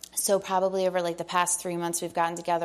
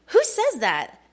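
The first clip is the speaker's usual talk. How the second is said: louder, higher amazed who says that; delighted disbelief, a touch loud; amazed exclamation; 7 semitones higher, 7 dB louder, slower than usual. Retold or imitated. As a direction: call it out in happy disbelief. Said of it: "I literally went this".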